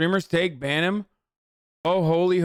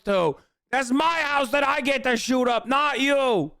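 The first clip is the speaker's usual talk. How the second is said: pitched-up, jokey imitation of a ranting man; mock ranting character; 6 semitones higher than usual. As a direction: lightly voice the guy claiming the house as his to shoot up. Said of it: "it almost feels like he's like".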